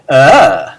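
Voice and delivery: "Uh" loud, springing up high then dropping low; high-low pitch demo. Shown as a demonstration. Teacher showing students how a circumflex sounds, high-low pitch glide on "Uh". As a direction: swoop "Uh" sharply up, then let it fall. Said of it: "would probably be pronounced something like".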